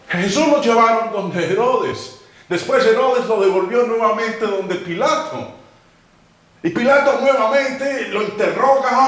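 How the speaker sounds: high, loud, rising sermon delivery; fervent preaching. Acted. Pastor driving home Jesus's innocence, fervent preaching. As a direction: preach it with mounting fire.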